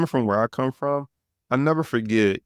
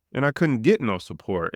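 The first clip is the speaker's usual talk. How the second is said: ordinary talk, nothing marked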